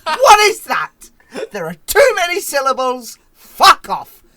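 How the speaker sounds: high, loud imitation of a ranter; mock outraged yelling. Co-host imitating the friend's slang rant, mock furious shouting. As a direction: yell it in exaggerated outrage. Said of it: "and then you're like".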